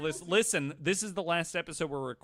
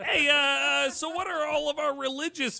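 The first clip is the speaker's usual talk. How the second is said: much higher, louder, bright announcer voice; mock chipper host; 11 semitones higher, 4 dB louder than usual. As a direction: ham up a cheesy interviewer launching the topic. Mock podcast host opening question.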